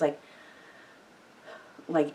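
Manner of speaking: ordinary talk, nothing marked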